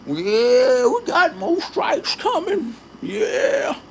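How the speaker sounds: high, taunting voice of a gloating hater; mocking impression. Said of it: "dude was over there sharing out".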